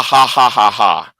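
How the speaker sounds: a low, fading imitated laugh dropping away; lone low laugh. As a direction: let the laugh sink and die out alone. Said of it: "only one person saying ha".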